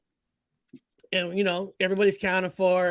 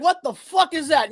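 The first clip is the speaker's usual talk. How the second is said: higher, louder startled outburst; shocked exclamation; 7 semitones higher, 5 dB louder than usual. Retold or imitated. Uncle spotting the creature from the car, retold shocked yell. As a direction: blurt it out like you can't believe your eyes.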